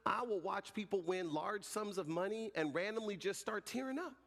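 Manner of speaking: ordinary talk, nothing marked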